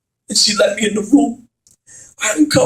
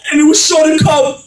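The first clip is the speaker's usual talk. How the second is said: anguished declaration; 5 semitones higher than usual. Acted. Climactic confession, loud.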